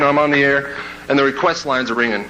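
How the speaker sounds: ordinary talk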